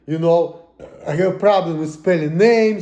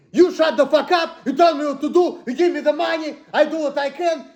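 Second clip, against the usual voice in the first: high, raised voice, nearly shouting the terms; heated rant; 8 semitones higher than usual. Real, not acted. Vlogger venting to camera, raised near-shout. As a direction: bark out how it should work, fed up. Ranting about what freedom means.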